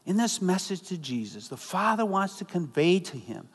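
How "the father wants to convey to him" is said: ordinary talk, nothing marked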